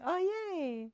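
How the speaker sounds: high-pitched happy exclamation; bright burst of delight at a compliment. Real, not acted. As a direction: react with a pleased little squeal. Delighted reaction to listener's praise.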